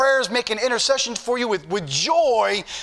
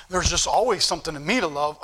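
mock smug boast; 6 semitones lower than usual. Preacher mocks a proud believer's boast to the congregation, lower and smug. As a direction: put on a self-satisfied churchgoer.

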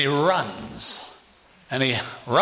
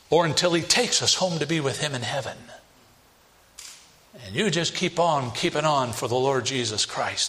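normal talking voice

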